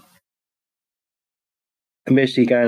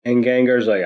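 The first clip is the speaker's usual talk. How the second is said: ordinary delivery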